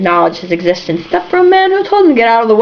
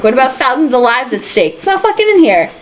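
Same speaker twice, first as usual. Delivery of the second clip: ordinary delivery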